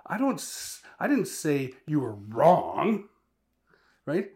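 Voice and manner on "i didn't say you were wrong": didnt rising, loud, half speed; slow loud take leaning on didnt; stress on DIDN'T, slow. Shown as a demonstration. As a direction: stretch and lift didnt. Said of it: "put a different emphasis and inflection".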